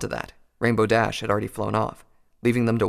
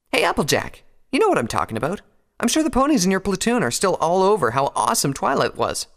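higher-pitched, boastful challenge; rally a friend to your side, pitched up; brash pony character; 10 semitones higher, 3 dB louder than usual. Acted. Voices brash Rainbow Dash.

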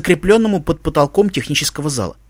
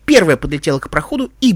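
ordinary delivery

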